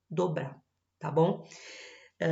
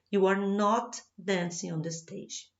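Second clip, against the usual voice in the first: you are not dancing on the stage, said slowly and clearly; slow example sentence; slower than usual. Shown as a demonstration. Teacher reading the negative example slowly to students. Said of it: "a mesma frase na negativa fica".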